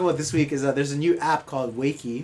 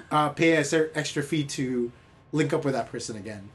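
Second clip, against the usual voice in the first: usual speaking voice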